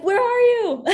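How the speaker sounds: very high, loud cry breaking into a laugh; David screaming at God. Retold or imitated. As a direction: throw the question up at God, then crack into a laugh. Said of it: "I can see David screaming that".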